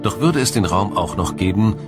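ordinary talk

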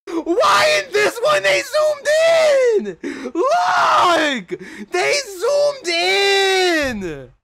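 high, loud shocked shout; shocked yelling. Real, not acted. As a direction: yell in disbelief at the screen. Shocked at zoomed-in photo.

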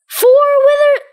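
high, loud squeal of delight; a thrilled high shriek; excited squeal. Real, not acted. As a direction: burst out at the find. Finds fourth skull.

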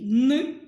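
loud, clear «н» sound; isolated sound. Shown as a demonstration. Teacher sounding out Ніна's third sound, loud. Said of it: "третій".

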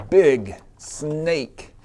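usual speaking voice

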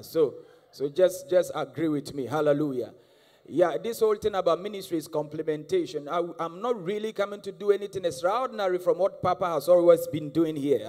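ordinary talk, nothing marked

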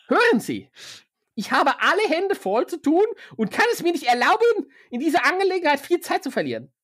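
busy broker brushing them off; brusque broker voice. Acted. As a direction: hurry them to work. Broker continues.

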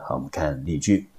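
ordinary delivery

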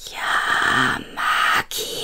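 low, hissing creature whisper; a monster hissing back from the dark; hissed. Acted. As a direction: hiss it out of the darkness. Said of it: "zischte es nun laut".

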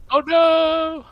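high, loud dismayed shout; dismayed cry. Real, not acted. Sees teammate die.

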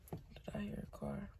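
a quick unvoiced whisper. Real, not acted. Pausing the video, she whispers to herself about a car. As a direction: hush it, listening for a car.

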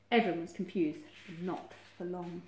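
plain reading voice

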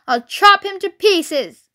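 menacing shout. A narrator's reading. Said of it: "shouted Bunce".

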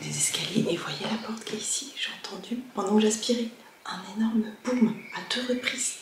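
hushed whisper, nervous. Real, not acted. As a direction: whisper it, afraid of a noise. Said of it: "Je sais pas pourquoi je parle tout bas".

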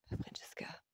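very soft, whispered name; murmur. A narrator's reading. Man calling her as she dabs her eyes, hushed murmur. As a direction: breathe her name gently. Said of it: "murmura-t-il".

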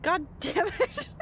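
drawn-out exasperated curse at the game; frustrated outburst. Real, not acted. Gamer swearing at a glitch, drawn-out and exasperated. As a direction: let the glitch get to you, dragging it out.

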